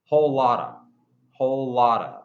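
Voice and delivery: Whole lotta, of reduced to a; Whole lotta, linked and reduced. Shown as a demonstration. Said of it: "the of sounds like a".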